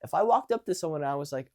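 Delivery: ordinary talk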